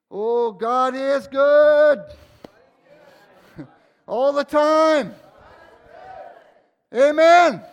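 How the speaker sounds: loud, high, slow exultant praise; real joyful cry of praise; joyful praise shout. Real, not acted. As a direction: belt it out in joy. Joyful outburst in worship.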